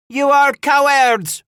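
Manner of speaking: very high, loud, slow shriek. A narrator's reading. A woman in the stockade insults the attackers, shrieking. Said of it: "a female voice shrieked at them".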